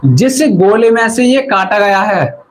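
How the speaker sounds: normal talking voice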